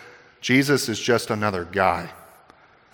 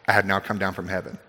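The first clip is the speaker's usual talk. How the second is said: a mocking quote, softer and lower, x1.5 fast; 6 semitones lower, 7 dB quieter, faster than usual. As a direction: sneer it out quickly like a scoffing crowd. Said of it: "I assume this is mockingly".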